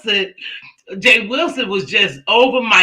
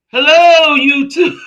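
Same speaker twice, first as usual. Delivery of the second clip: high, loud mock greeting; imitating Uncle Tim; 7 semitones higher, 8 dB louder, slower than usual. Friend mimicking Uncle Tim opening his live, high sing-song hello. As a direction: call out hello like a goofy streamer. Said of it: "When he said".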